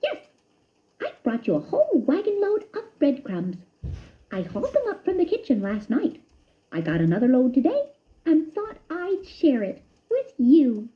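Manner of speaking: high friendly mouse voice; a cheerful cousin offering a gift; lightly voiced happy mouse; Ced the cousin. A narrator's reading.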